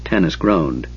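plain reading voice

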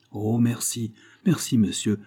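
ordinary delivery

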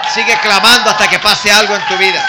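loud, full-force preaching shout; rousing exhortation. Real, not acted. Preacher urges youths to keep crying out, shouting. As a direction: drive it home over the crowd. Preacher urging crowd to cry out.

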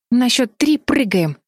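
high, slow, deliberate order; urgent command. A narrator's reading. Бетти readies her sisters to jump, urgent command. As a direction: give the count instruction firmly and slowly.

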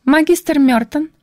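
unnaturally high, squeaky little voice. Acted. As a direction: squeak it out in a fake girly pitch. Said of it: "неестественно высоким писклявым голоском".

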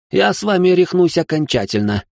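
выше, чопорно и обиженно; отстаивай честь высшего общества; возмущённый аристократ. A narrator's reading. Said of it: "признал поражение Ричард". (аристократ Ричард спорит с Салихом, выше и обиженно)

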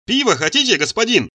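high, lively hail; cheerful calling out. A narrator's reading. Tipsy slave offers master beer from doorway, calling out. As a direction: call it out as you enter. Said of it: "закричал он ещё с порога".

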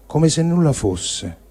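ordinary delivery

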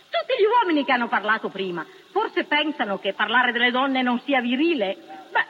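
ordinary talk

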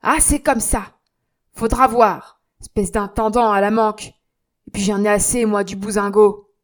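loud, insolent outburst; angry protest. Acted. Concierge insulting Jean, loud insolent protest. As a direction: talk back rudely. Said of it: "voulut protester".

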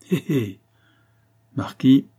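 a mild mocking snicker in the words; sneering. A narrator's reading. Zampa taunts Rocambole once he has sat down, sneering. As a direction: needle him with a little sneer. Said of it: "ricana le Portugais".

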